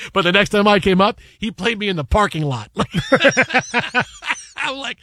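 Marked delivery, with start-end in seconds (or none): 2.7-4.5 high, real burst of laughter; laughing hard mid-story; hearty laugh. Real, not acted. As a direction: crack up at your own story. Cracks up at his own story.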